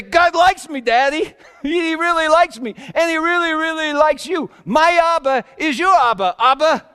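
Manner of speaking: high, loud, laughing delight; giddy joyful child. Retold or imitated. Son telling his father God likes him, laughing and giddy. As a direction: bubble over with joy like a dancing kid. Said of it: "he'd just start giggling and dance".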